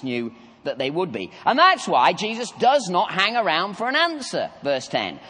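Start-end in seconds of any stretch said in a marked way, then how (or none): none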